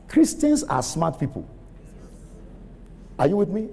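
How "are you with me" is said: ordinary talk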